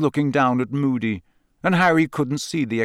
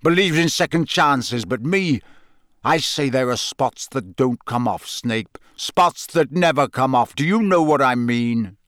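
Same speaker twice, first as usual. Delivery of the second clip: plain reading voice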